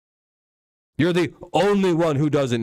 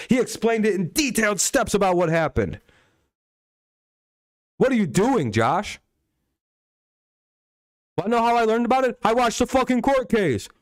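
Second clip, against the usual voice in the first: much higher, hammering the point home; heated berating; 8 semitones higher than usual. Real, not acted. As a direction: lose patience and lecture him hard. Berating Josh about Michael Vick.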